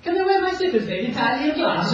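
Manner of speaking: put-on high, loud girlish plea; sweet daughter asking. Cinderella asking her father about glass slippers, high and sweet. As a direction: ask it all innocent and high.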